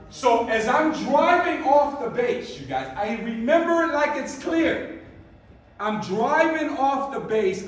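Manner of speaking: excited build. Real, not acted. Reliving the moment, building up.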